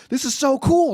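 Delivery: high, loud awed-kid imitation; dad mimicking an amazed daughter; daughter's excitement. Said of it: "And she's like".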